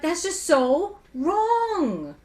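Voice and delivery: slow, high, drawn-out disbelief; mock outraged quote; indignant. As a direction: stretch it out, appalled. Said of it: "I'm like".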